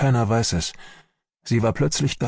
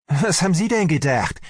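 higher-pitched, exasperated retort; shouting at someone; 8 semitones higher than usual. A narrator's reading. Army doctor answers his colleague's question, shouting at him. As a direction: snap back at a foolish question. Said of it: "schrie mich Sellnow an".

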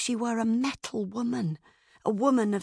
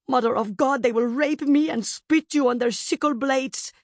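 loud, higher-pitched frightened outburst; terrified nurse; 6 semitones higher, 5 dB louder than usual. A narrator's reading. Nurse to the child as the camp burns, in loud terror. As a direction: cry out in horror.

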